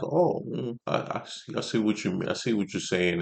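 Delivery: soft, half-whispered hesitant "I see"; mumbled unsure agreement. Retold or imitated. Coworkers murmuring a vague reply to the guy's remark, hushed and hesitant. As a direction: mimic noncommittal murmuring, trailing quiet.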